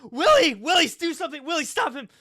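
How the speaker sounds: high loud pleading yell; desperate shout. Acted. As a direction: call out to Willie for help. Character shouts for ally.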